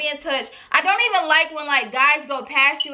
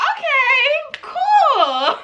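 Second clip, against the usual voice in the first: much higher, drawn-out reply breaking into laughter; high-pitched agreement; 10 semitones higher, much slower than usual. Retold or imitated. Retold reply to odd demand.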